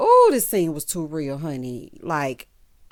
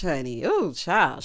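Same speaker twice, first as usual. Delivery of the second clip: ordinary delivery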